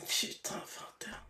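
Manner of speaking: hushed, very soft disbelief to oneself; whispered aside. Real, not acted. Host alone after the young caller hangs up, whispered disbelief. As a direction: whisper it under your breath.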